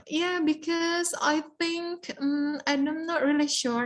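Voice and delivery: slow, hesitant answer with drawn-out words; unsure candidate. Shown as a demonstration. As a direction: stall as if unsure what to say. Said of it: "Nah, itu jangan gitu ya".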